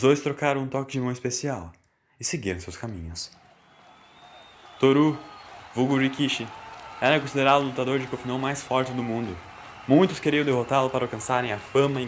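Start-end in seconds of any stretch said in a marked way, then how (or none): none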